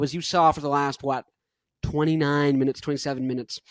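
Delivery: ordinary talk, nothing marked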